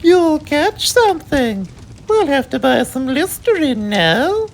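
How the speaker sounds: very high put-on fussy mother voice; high falsetto mum, fussing; Mummy's voice. A narrator's reading. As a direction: fret like a posh mummy. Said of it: "screamed Mummy".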